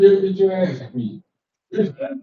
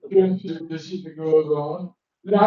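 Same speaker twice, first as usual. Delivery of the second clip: ordinary talk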